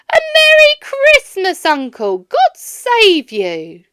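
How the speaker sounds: loud, high and bright, greeting the uncle; cheerful greeting. A narrator's reading. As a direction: burst in full of holiday cheer. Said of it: "cried a cheerful voice".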